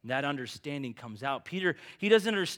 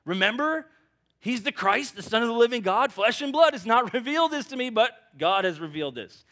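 higher, louder proud boast with a laugh; boasting Peter; 8 semitones higher, 9 dB louder than usual. Retold or imitated. Peter boasting to the other disciples, loud and proud. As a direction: lecture the others like the star pupil.

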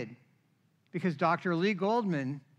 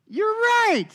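high, loud, gushing you're right; squealing with delight; mock elated agreement; 8 dB louder than usual. Telling the doctor how thrilled he was.

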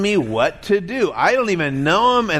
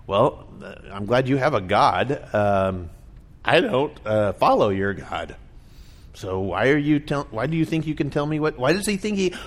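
skeptical people; 5 semitones lower, 5 dB quieter than usual. Retold or imitated. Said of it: "what I hear people all the time telling me, like".

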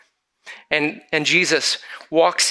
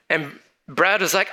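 normal talking voice

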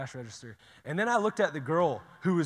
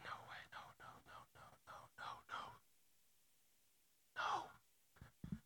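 breathless whispered no, no, no; hushed, pleading whisper of denial; inner whisper; 18 dB quieter, much faster than usual. Retold or imitated. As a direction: whisper it like a thought you can't stop. Inner panic at the couple.

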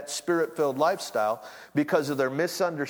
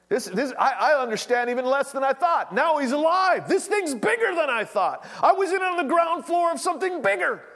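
rising to an excited shout, much higher; Peter's wonder bursting out loud; excited realization; 9 semitones higher, 3 dB louder than usual. Acted. Preacher voicing Peter, shouting with excited wonder.

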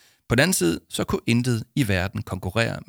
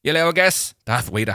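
higher and louder, laughing; laughing joke; 8 semitones higher, 6 dB louder than usual. Acted. Paul jokes to Arthur, laughing and louder. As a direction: tease the boy through a laugh. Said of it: "Grinte Paul".

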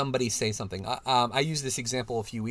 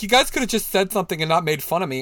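higher, louder mock whine; whiny complaint; 6 semitones higher, 6 dB louder, faster than usual. Facebook woman complaining about being mocked, mock whine. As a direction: play her as hurt and whiny. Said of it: "And she's like".

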